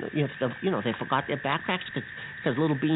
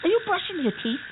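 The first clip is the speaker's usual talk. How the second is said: high disbelieving outburst; can't believe she's brushing teeth; exasperated disbelief; 10 semitones higher than usual. Acted. Betty brushing teeth mid-rescue.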